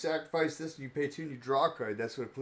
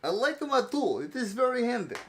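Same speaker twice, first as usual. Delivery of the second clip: put-on high-pitched Bratislavan accent; playful Bratislavan character, pitched up; 8 semitones higher, 4 dB louder than usual. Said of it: "stop doing the accent so much".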